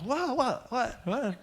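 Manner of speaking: high disbelieving what what; astonished. Real, not acted. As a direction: sputter in amazement. Astonished reaction to vanishing angel.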